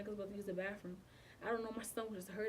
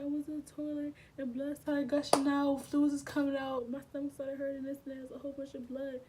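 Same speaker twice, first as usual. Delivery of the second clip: higher-pitched, urgent recounting; mildly panicked, raised voice; retold frantic report; 5 semitones higher, 6 dB louder than usual. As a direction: blurt out the emergency, reenacting alarm. Acting out frantic report to ex's mom.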